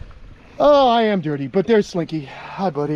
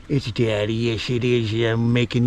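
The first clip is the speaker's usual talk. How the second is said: cooing to a pet; 5 semitones lower than usual. Real, not acted. Cooing to his pet turtle.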